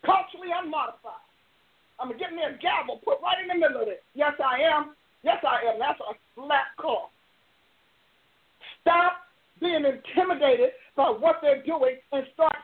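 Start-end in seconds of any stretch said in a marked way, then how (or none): none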